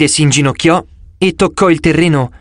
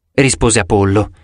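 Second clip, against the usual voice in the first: plain reading voice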